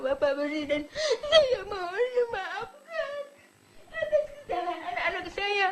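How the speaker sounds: real sobbing plea, high-pitched; sad, crying. Real, not acted. Mother begs the President to forgive her son, sobbing. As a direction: beg for forgiveness through tears, voice rising high. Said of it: "Saya sedih".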